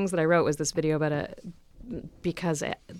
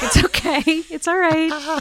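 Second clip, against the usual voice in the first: high, loud, drawn-out soothing; over-sweet pitched-up reassurance; mock reassurance; 12 semitones higher, 6 dB louder, slower than usual. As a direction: sing out an awkward there-there. Reassuring an awkward apologetic fan.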